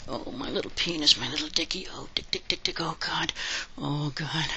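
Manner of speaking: high, silly sing-song babble, dropping to a breathy whisper. Real, not acted. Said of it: "you can hear me starting to get pretty silly".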